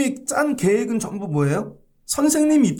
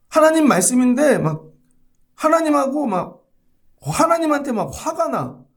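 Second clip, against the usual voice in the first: mock indignation; 6 semitones higher, 3 dB louder than usual. Angry at God's word.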